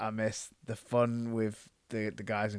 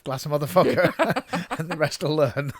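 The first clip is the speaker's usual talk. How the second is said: loud laughing repeat; 5 semitones higher, 7 dB louder than usual. Acted. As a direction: punch it out loud, breaking into laughter. Repeats the joke louder, laughing.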